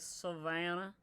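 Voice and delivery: exaggerated Southern US drawl; playful mock Southern twang; deep Southern accent. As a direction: imitate a friend's thick Southern accent. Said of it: "really Southern accent for, like, no reason".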